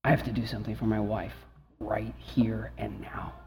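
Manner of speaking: low, very soft inner voice; hushed resolve. Retold or imitated. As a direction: drop to a low hush, resolved. Inner resolve at the crucial moment.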